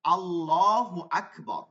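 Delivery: আল্লাহু with a thick, full-mouthed লা, slow and drawn out; heavy full L in Allah. Shown as a demonstration. Teacher demonstrating the heavy L in the takbir. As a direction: chant আল্লাহু slowly with a heavy L. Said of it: "যেমন".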